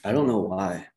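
hoarse, tired voice from losing it. Real, not acted. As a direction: speak through a voice that's going hoarse. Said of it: "I'm losing my voice".

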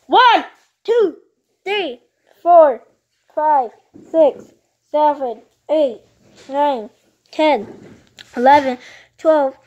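loud, clear counting aloud. Real, not acted. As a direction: count the numbers loudly. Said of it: "Count out loud".

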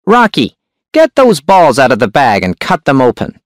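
high, sharp hunchback voice. A narrator's reading. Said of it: "The hunchback's voice was sharp and high".

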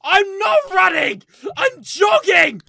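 loud indignant retort; indignant shout. Real, not acted. Player protesting to the principal, indignant shout.

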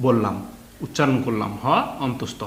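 usual speaking voice

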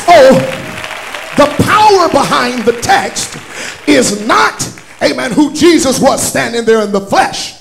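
loud, rising preacher's call over music; full-force preaching. Acted. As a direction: drive it home to the congregation. Preaching builds again to shouting.

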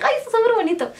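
high, bright gush over pretty earrings; delighted gush. Retold or imitated. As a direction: squeal it like you just found them. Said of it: "porque dije". (exclamación encantada, mucho más aguda)

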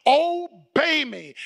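obey me drawn out slowly; slow emphasis. Shown as a demonstration. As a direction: lean hard on obey, slowly. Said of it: "If you can just catch that".

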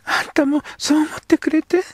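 put-on high girl's voice, hopeful; a girl seeking her friend's approval; young girl. Girl character speaks.

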